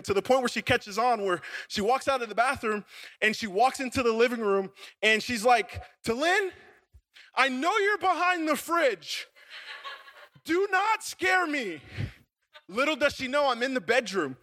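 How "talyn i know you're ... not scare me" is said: high, slow warning call; imitating wary wife. Wife calls to husband hiding behind fridge, mock wary warning.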